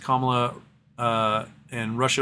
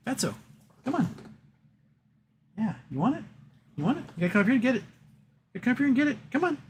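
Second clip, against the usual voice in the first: much higher sing-song coaxing to a cat; cooing at the cat to come get catnip; pet talk; 11 semitones higher, 4 dB quieter than usual. Acted. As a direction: coax the kitty up like baby talk.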